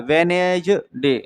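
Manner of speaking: वेनेसडे drawn out slowly without the first D; silent D, slowly. Shown as a demonstration. Teacher demonstrating the silent D, वेनेसडे said slowly. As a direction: stretch वेनेसडे out as "ve-nej... de". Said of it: "डी silent होता है".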